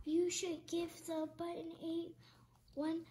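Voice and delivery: soft, secretive urging; sneaky soft tempting voice; conspiratorial hush. Acted. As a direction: coax her quietly, no one is looking.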